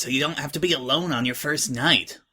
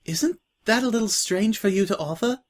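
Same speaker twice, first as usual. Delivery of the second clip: lightly voiced timid filly; 8 semitones higher than usual. New girl to Scratch, a hint of timid surprise.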